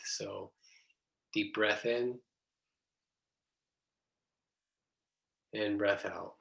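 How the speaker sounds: soft, hushed breathing guidance with long pauses; guided meditation. Real, not acted.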